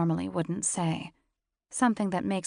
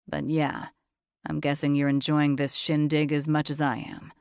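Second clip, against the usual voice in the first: lower, husky-free steady man's voice; low male voice; 3 semitones lower, 4 dB louder than usual. A narrator's reading. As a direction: voice him low and cool.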